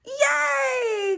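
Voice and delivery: high, loud joyful yay; excited cheer. Real, not acted. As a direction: celebrate her success. Toddler finishes the buckle.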